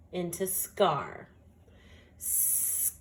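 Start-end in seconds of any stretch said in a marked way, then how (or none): 2.2-3.0 whispered word. Shown as a demonstration. Teacher whispers the word while checking the letters. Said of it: "I'm gonna slide under my word to see".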